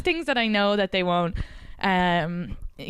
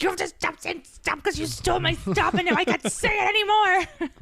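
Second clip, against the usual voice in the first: much higher, playful outraged complaint; mock angry; 10 semitones higher than usual. Wife scolds husband for copying her word, mock outrage. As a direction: scold him half-joking for stealing her word. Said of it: "I actually got mad at him".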